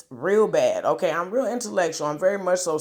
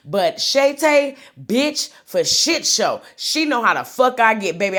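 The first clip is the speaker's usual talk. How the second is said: much higher, louder, slow drawn-out emphasis; emphatic rant; 7 semitones higher, 7 dB louder than usual. Real, not acted. Ranting to viewers about Shay Tay, loud and slow. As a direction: lean hard into each word.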